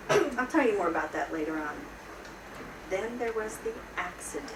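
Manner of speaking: ordinary delivery, nothing marked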